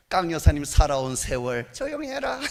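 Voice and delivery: ordinary talk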